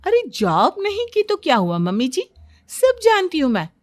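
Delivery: animated, emphatic boasting; swooping, self-assured storybook character voice; know-it-all daughter-in-law. Acted. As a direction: lecture them like an expert on everything. Daughter-in-law boasts about knowing everything.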